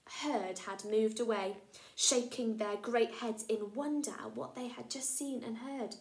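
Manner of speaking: plain reading voice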